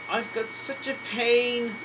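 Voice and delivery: loud, high, drawn-out wail of complaint; pained moan. A narrator's reading. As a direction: moan it loudly, stretching the words. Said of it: "moaned James".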